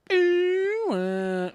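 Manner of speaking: high, loud, drawn-out whale moan; Dory speaking whale. Retold or imitated. Streamer doing Dory speaking whale, high loud moan. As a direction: stretch it like a whale call. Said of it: "I know how to speak whale".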